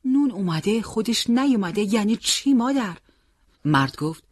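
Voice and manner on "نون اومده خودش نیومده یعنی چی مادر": higher, louder astonished question; puzzled surprise. A narrator's reading. Son behind his parents questioning mother, surprised. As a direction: ask in open bewilderment. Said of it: "تعجب پرسید".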